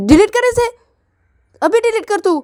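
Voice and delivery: very high, loud command to delete; furious demand. Acted. Girl shouts at boy to delete her photo, furious. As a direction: shriek at him to delete it now.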